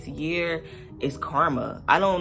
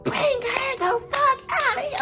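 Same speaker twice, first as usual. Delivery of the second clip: mock scream, much higher; retelling a friend's yell, much higher mock scream; imitating a scream. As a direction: squeal it like she screamed at her. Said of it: "while she's screaming".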